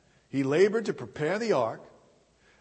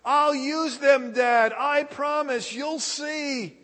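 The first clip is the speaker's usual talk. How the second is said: put-on much higher and louder teen promising; eager pleading teen; 10 semitones higher, 6 dB louder, slower than usual. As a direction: insist to Dad like a desperate kid. Teen keeps pleading with father.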